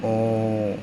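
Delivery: ओ sung out long and even; long o sound. Shown as a demonstration. Teacher holding ओ for the students. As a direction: hold ओ as a long vowel. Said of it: "ओ की आवाज़ आएगी लंबी".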